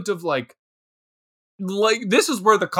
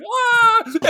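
mock scream. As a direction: scream like fleeing a falling mower. J-Lo screaming at the mower.

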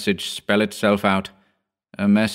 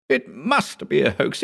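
put-on insistent young assistant; 6 semitones higher than usual. A narrator's reading. Hugh insisting.

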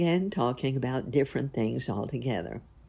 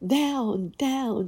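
higher shriek, down, down; shrieking wife; 8 semitones higher than usual. Acted. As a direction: shoo the dog and cat off her nose. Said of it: "shrieked Joanna".

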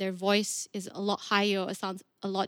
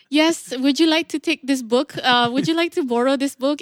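a light, polite librarian at the desk, a bit louder; 4 semitones higher, 6 dB louder than usual. Retold or imitated. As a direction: offer the book like a courteous librarian. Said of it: "my voice is a voice of a librarian".

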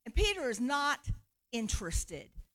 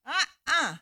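mock refusal; 4 semitones higher, 6 dB louder than usual. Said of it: "it comes a second time".

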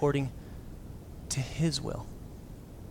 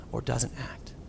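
whispered; 4 semitones lower, 7 dB quieter, slower than usual. Real, not acted. Sermon trailing hush.

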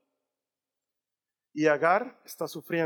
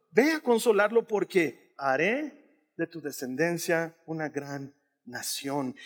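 solemn angel; 3 semitones lower, slower than usual. Acted. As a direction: speak it like a gentle heavenly promise. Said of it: "el ángel de Dios llamó".

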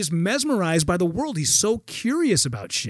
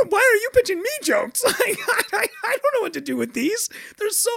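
much higher, laughing through the words; laughing disbelief. Retold or imitated. Baffled that his hero pitches him jokes, laughing. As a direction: giggle it out, flustered.

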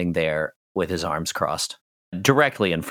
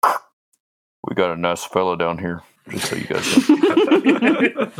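slow, put-on doorman voice; drawn-out radio report from the bouncer; doorman on walkie-talkie; 3 dB louder, slower than usual. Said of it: "talks into his walkie-talkie and says".

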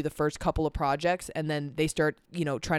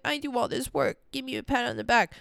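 mock whiny self-pity; 8 semitones higher than usual. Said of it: "I don't wanna sit here and be like".

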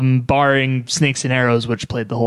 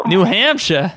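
mock Boston-ish accent, dropped R; 4 semitones higher than usual. Host teasing guest about where she lives, playful Boston-ish accent. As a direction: try on a higher-pitched Boston-ish accent. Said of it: "like, Boston-ish accents there".

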